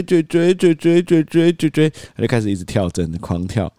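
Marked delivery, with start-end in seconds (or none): 0.0-1.9 higher, rapid repeated babble; mimicking toddler babble. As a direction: rattle it off like a stuck child. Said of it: "一直追追追追". (模仿小孩跳针似的重复)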